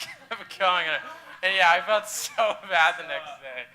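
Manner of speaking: giggling through the words; laughing. Real, not acted. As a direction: laugh while telling it.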